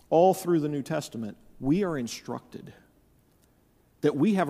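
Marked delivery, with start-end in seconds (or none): none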